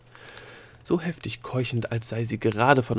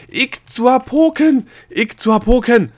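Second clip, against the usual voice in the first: very high, very loud, slow shriek; piercing, drawn-out scream; shrieking at the top of her voice; 13 semitones higher, 12 dB louder, slower than usual. A narrator's reading. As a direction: shriek it as high as she can. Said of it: "kreischte sie in den höchsten Tönen".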